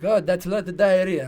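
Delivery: imitated Russian accent, booming remark. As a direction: announce it as a tactless Russian doctor. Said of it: "like, Russian accent".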